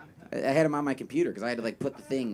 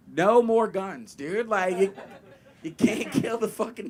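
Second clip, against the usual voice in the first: slow plea; 6 semitones higher, 5 dB louder than usual. Retold or imitated. Crew pleads with guard.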